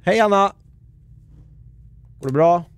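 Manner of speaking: high, loud hello; calling out greeting. Real, not acted. Streamer greets a viewer joining the stream, calling out. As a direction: call out to someone who just dropped in.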